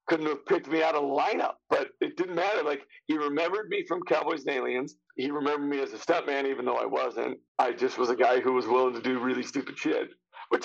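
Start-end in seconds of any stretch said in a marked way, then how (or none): none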